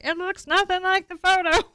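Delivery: high, loud heckle imitating a doubter; mock onlooker. Painting teacher imitates an onlooker judging the early stripes, mock complaint. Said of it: "somebody that comes by and is like".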